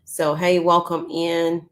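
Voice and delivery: usual speaking voice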